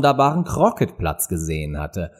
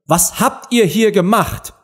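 high, slow interrogation; stern queen; 9 semitones higher than usual. A narrator's reading. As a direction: demand an answer as a domineering queen. (strenge Königin)

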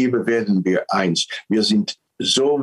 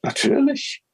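Eve answering. Retold or imitated. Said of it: "Und dann sagt die Schlange".

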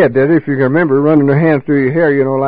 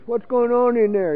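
higher, softer wife calling through the door; wife's voice; 8 semitones higher, 6 dB quieter than usual. Retold or imitated. Wife peeking out the door asking what's happening, higher and softer.

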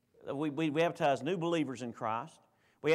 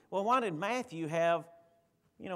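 usual speaking voice

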